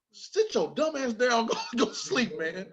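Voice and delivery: high, drawn-out mock dad order; scolding father. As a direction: order the boy to bed as a fed-up dad. Father orders child to bed.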